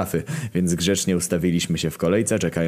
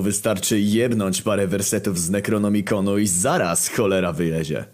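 usual speaking voice

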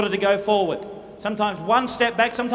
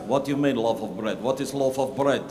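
much lower, deep puzzled voice; puzzled soldier; 10 semitones lower, 4 dB quieter than usual. Pretend. The other soldier asks about the bread, deep and baffled. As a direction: drop way down to a baffled grunt. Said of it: "The other guy says".

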